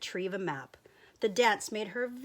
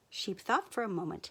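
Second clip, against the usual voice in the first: ordinary delivery, nothing marked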